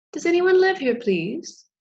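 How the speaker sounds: high, loud call that dips on please; high butterfly voice. Acted. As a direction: knock and call in a small high voice. Butterfly knocks a second time.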